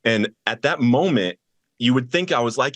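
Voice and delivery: ordinary delivery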